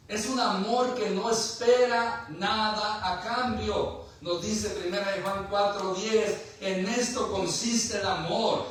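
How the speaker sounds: loud, high-pitched sermon delivery; preaching at full force. Real, not acted. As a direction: drive the scripture home, loud and sustained. (predicando con fuerza, voz alta y aguda)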